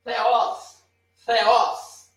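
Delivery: Theos said with a breathy th, like f-eos; Theos, airy th sound, not a hard T; teaching pronunciation. Shown as a demonstration. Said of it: "Theos, eu não falo teos".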